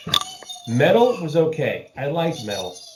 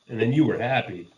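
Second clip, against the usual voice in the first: ordinary delivery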